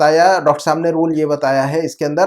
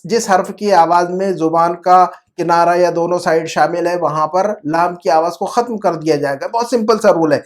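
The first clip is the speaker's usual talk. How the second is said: ordinary talk, nothing marked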